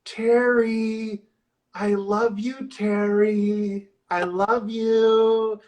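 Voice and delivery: slow, drawn-out eerie call; mock creepy voice. Retelling the creepy voice calling Terry, slow and eerie. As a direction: lure him in like a ghost. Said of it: "he hears".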